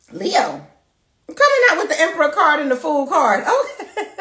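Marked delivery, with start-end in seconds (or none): 1.3-4.2 high, loud, laughing through the words. Real, not acted. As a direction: crack up at the cards while reading them. Laughing at the card pull.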